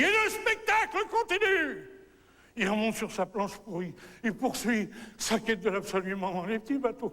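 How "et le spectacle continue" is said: very high, sustained shout; crying out. Acted. Artist in the water crying out to his audience, high ringing shout. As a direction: proclaim it at the top of the voice. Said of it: "il crie".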